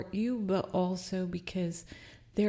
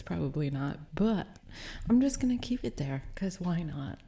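ordinary talk

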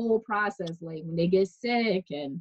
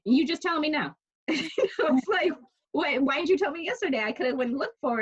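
mock indignation; 8 semitones higher than usual. Retold argument with husband.

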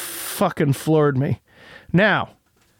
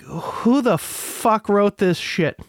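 slow, higher-pitched disbelief; incredulous critic, pitch jumping up; exasperated; 6 semitones higher, slower than usual. Retold or imitated. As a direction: draw it out in appalled disbelief. Exasperated reaction to bad writing.

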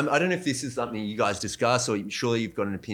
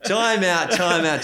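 high, laughing interruption; incredulous laugh in a raised pitch; amused disbelief; 6 semitones higher, 3 dB louder, slower than usual. Real, not acted. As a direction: halt everything, laughing in disbelief. Halting talk in amused disbelief.

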